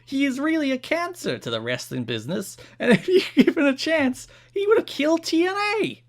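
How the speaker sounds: high, loud cartoon New Jersey accent, cracking into laughs. Reading a fan's letter to Jarrett in a New Jersey accent. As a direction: read the fan letter like Joey Wheeler. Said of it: "read this in a New Jersey accent".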